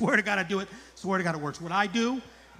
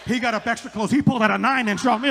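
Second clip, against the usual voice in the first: usual speaking voice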